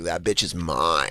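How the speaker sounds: very low, slow, soft boast; two-faced boyfriend aside. Acted. Boyfriend turning from the girl's mother, low slow two-faced aside. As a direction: drop down into a sly slow undertone. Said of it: "he turns around".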